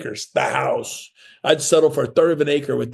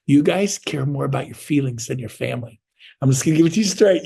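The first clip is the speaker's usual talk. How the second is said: ordinary delivery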